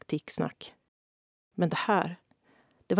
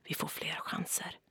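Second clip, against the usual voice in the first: whispered, much softer; quiet comfort; 10 dB quieter than usual. A narrator's reading. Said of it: "sa tyst".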